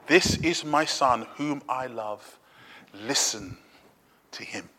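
low, slow, hushed scripture quote; measured, reverent quiet; solemn divine voice. Acted. Reads God's voice from the cloud.

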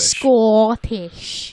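loud, drawn-out mock Scottish accent; attempted Scottish accent. Shown as a demonstration. Answering the co-host's correction, hammed-up Scottish accent. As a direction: overdo a Scottish "Scottish", stretched vowel. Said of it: "That's not Scottish".

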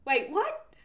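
high, questioning double take; imitated surprise. Brother catching on to the news, imitated bafflement. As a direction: mimic a baffled reaction.

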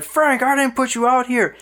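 imitating a frantic movie scene; panicked shout at a mannequin; movie hero freaking out. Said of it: "starts freaking out at the mannequin".

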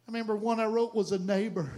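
ordinary delivery

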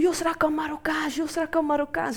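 high, excited child's yell, imitating the cousin; retold yell. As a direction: call it out like a kid telling on someone. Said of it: "En hij begint te schreeuwen". (nagespeelde schreeuw van een opgewonden kind)

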